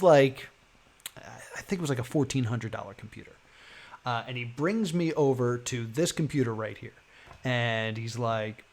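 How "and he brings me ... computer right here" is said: ordinary delivery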